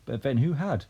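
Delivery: ordinary delivery, nothing marked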